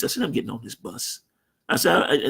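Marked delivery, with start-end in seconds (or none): 0.2-1.2 calm and at peace. Retold or imitated. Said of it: "And I just had a peace".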